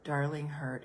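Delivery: soft, tender, almost whispered; How can I help you, darling hurt, whispered with mercy; compassionate whisper. Shown as a demonstration. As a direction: breathe it gently to the hurt like a parent. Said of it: "do you hear the tone in my voice? It's compassion. It is mercy".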